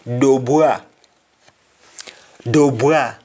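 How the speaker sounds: "Dubois" said doh-BWA; "Dubois" with silent s, open wah; Jamaican wa form. Shown as a demonstration. Teacher showing the changed name, "Dubois" as doh-bwa. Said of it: "Du Bois will become Dubois".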